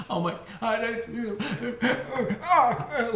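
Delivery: high, hammed-up frightened blubber; mock scared crying. Upset kid facing the principal's office, mock sobbing. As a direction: imitate a child sobbing too hard to talk. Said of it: "I was so scared".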